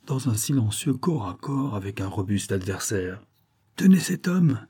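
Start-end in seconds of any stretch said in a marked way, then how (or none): 3.8-4.7 high, urgent cry for help; cri d'alerte. A narrator's reading. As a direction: call out an order, pitched well up. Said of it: "cria-t-il en me voyant". (cri d'alerte, voix haute et pressante)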